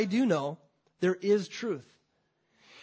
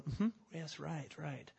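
soft, low yes-man mumble; mock agreeable murmur; 3 semitones lower, 10 dB quieter, slower than usual. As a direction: nod along without a clue. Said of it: "All the other guys were probably going".

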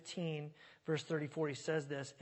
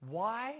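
"Why?" alone, higher, pitch rising; trying emphasis on WHY; 5 semitones higher, 3 dB louder than usual. Shown as a demonstration. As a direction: lift "Why?" into a lone question. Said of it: "I don't know where to put the emphasis".

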